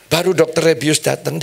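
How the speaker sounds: ordinary delivery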